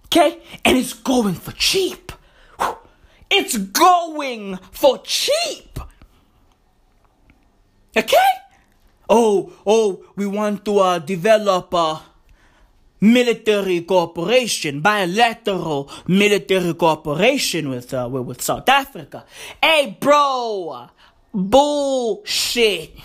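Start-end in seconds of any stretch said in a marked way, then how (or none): 3.3-5.8 very slow, higher-pitched emphatic repeat; drawn-out emphasis. Real, not acted. 9.1-19.3 hesitant, lower-pitched mock Russian accent; horrible Russian accent. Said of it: "Horrible Russian accent".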